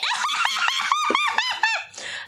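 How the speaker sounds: very high swooning giggle; swooning maiden laugh. Pretend. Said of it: "That's my swooning maiden laugh".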